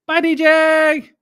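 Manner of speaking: put-on high, slow kid voice; squeaky child calling out; mimicking a kid. Imitating David at bedtime.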